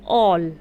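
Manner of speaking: all said with a loud open aw; model word. Shown as a demonstration. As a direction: stress the aw in all. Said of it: "ऊ का जब sound आएगा".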